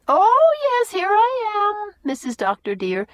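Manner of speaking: high, loud country housekeeper voice; high-pitched homely Susan bursting in. A narrator's reading. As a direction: bustle in as a chatty old servant. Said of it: "entering unexpectedly from the kitchen".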